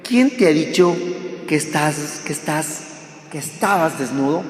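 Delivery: soft, pained question, rising on Quién; read as a real question. Shown as a demonstration. Preacher reading God's question to Adam, soft and pained. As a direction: ask "¿Quién te ha dicho" gently, as a sorrowful question. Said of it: "con signos de interrogación".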